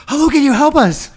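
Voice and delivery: loud, high-pitched frantic cry for help; panicked. Retold or imitated. Kid stuck in elevator calls for help, panicked. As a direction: imitate a freaked-out kid yelling into the phone. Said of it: "he's panicking, like, freaking out".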